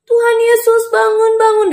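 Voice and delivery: high, urgent calling; pleading high-pitched wake-up cry; urgent waking call. Acted. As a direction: call out to rouse a sleeper. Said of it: "Bangunin Tuhan Yesus".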